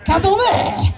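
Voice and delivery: cantonment cried high as "CAN-tle-meh!"; exaggerated local pronunciation. Shown as a demonstration. Comic relishes the town's name, cantonment as high "CAN-tle-meh". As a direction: pitch cantonment way up, ending on an open "meh". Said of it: "I like to say cantonment".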